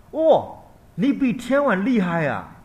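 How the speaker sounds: high, loud and slow amazement; mother amazed that her son outshines a deva king; astonished mother. Acted. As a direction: let the wonder stretch out. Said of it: "他的妈妈说".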